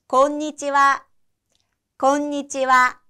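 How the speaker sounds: 곤니치와 said slowly, one syllable at a time; slow it right down so they can copy; slow classroom drill of 곤니치와; slow repeat-after-me. Shown as a demonstration. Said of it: "따라서 다시 해볼까요".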